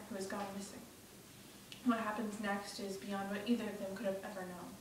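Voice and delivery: usual speaking voice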